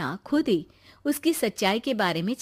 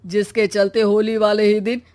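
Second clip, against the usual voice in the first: villager telling legend; 7 dB louder than usual. Acted.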